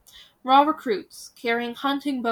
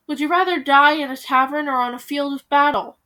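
loud urgent appeal; pleading cry; 4 semitones higher, 7 dB louder than usual. Acted. Hansel crying out to the villagers, loud appeal. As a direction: cry out to the leaving crowd. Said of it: "Hansel cried".

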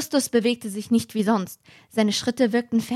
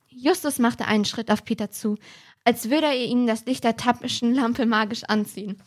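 usual speaking voice

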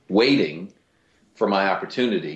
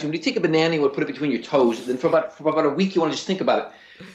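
fast, higher-pitched TV doctor patter; 7 semitones higher, much faster than usual. Acted. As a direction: race through a home remedy, words tumbling out. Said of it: "he's talking very fast".

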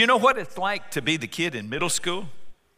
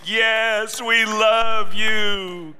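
high, loud, very slow gush; gushing family; 7 semitones higher, 7 dB louder, much slower than usual. Acted. Family greeting dad at the door, gushing. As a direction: drag it out in over-the-top adoration.

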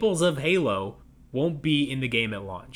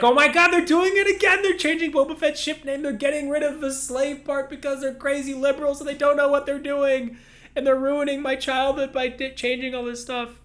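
high whiny mocking fan voice, louder; whiny outraged fan; 14 semitones higher, 3 dB louder than usual. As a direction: whine it like a furious fanboy. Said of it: "Everyone's like".

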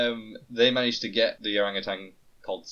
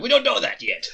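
high, loud clueless producer; high silly voice; 8 dB louder than usual. Retold or imitated.

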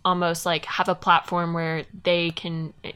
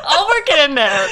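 laughing; 10 semitones higher, 6 dB louder than usual. Real, not acted. Teasing about mentioning Chalamet.

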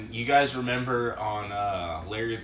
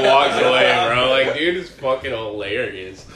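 ordinary talk, nothing marked

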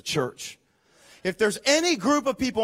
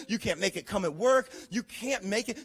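normal talking voice

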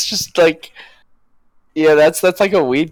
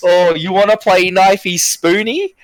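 slow, higher Aussie drawl mimicking The Simpsons; Australian accent; 5 semitones higher than usual. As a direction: stretch it out like a cartoon Australian. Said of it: "they're like".

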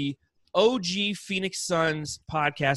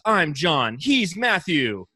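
usual speaking voice

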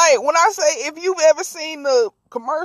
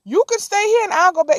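pleading; 9 semitones higher, 3 dB louder than usual. Retold or imitated. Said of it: "he said".

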